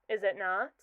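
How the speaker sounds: normal talking voice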